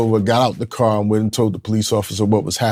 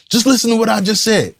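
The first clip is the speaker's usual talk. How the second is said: much higher, insistent retold shout; defensive shout; 13 semitones higher, 3 dB louder than usual. Father ordering his son to listen, high insistent shout. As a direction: demand he listen, deflecting. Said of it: "I'm like".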